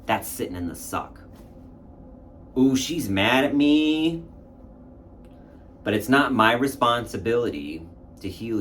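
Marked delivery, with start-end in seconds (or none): 2.6-4.2 higher, louder, slow mock ooh; a hammed-up, slow gasp of alarm. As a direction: play up the alarm teasingly. Mock reaction to mom's anger.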